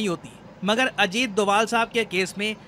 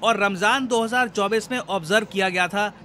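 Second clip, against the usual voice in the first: ordinary talk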